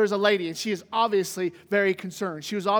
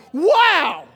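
amazed wow; 7 semitones higher, 9 dB louder than usual. Retold or imitated. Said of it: "lost people are going like".

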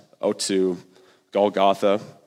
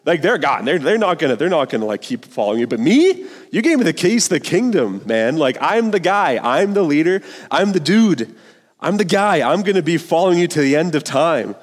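put-on much higher, brash young dude bragging; cocky bro Peter; 6 semitones higher, 3 dB louder than usual. Peter boasting to Jesus in a cocky bro voice. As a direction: swagger through it like a surfer bro. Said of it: "Peter's saying".

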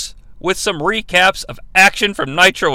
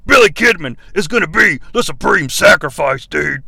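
Hulk Hogan voice; 3 semitones lower than usual. Retold or imitated. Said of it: "in a voiceover".

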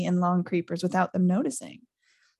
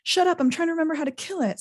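higher, fast, flustered Hermione snapping back; 9 semitones higher, faster than usual. A narrator's reading. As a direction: rattle it off high and fast in a panic. Hermione snaps back in panic.

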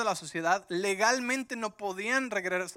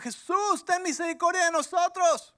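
much higher, loud pleading cry; desperate shout; 10 semitones higher, 7 dB louder than usual. Acted. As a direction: shout for mercy from far away. (grito desesperado y agudo)

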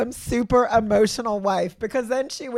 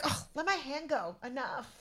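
a much softer, grumbling mock of him; dismissive brush-off; 2 semitones higher, 16 dB quieter than usual. Wife mimics husband pulling his hand away, mumbling mock brush-off. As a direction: shrug him off under your breath, lightly imitated. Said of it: "and you're like".

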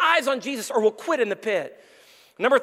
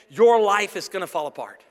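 ordinary talk, nothing marked

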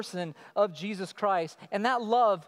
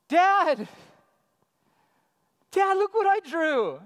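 put-on much higher, loud kid calling Dad; excited child; 9 semitones higher, 8 dB louder than usual. Child calling dad to show a drawing, higher and louder. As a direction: call out like a kid proud of a drawing.

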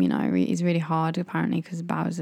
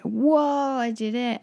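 funny voice; 6 semitones higher than usual. Retold or imitated. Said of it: "I did that funny voice".

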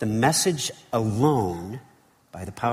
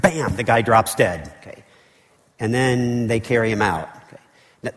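ordinary talk

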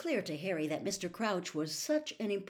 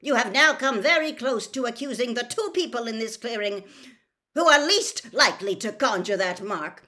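loud, high, angry bark; 6 semitones higher, 11 dB louder than usual. A narrator's reading. Mister Crouch rebuking Amos for accusing him, barking. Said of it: "barked Mister Crouch".

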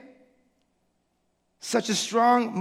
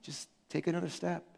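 softer, patient nudging; gentle coaxing God; 4 semitones lower, 11 dB quieter than usual. Retold or imitated. God gently urging his hesitant people onward, soft and patient. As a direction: coax them forward one step at a time. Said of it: "I think God is like".

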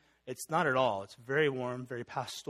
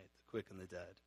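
put-on much softer, relishing the old words "The quick and the dead"; savoring the phrase; 6 semitones lower, 14 dB quieter than usual. Shown as a demonstration. Pastor savoring the old creed wording for his flock, soft and relishing. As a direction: murmur "The quick and the dead" as if tasting each word. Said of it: "This sounds cool".

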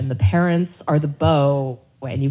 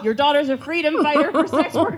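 laughing; 10 semitones higher than usual. Real, not acted. Laughing while quoting herself.

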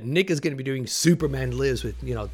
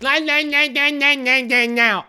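high, loud birdlike squawking; Big Bird squawk; 12 semitones higher, 7 dB louder than usual. Retold or imitated. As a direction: squawk like a giant goofy bird. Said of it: "He's like Big Bird".

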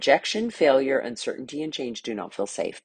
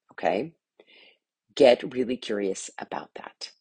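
ordinary talk

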